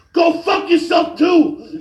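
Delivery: slow, high, very loud mock shout; retold yell. Old man turning on the sympathetic onlooker, yelling. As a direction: bellow it as a fed-up old man. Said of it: "he yelled".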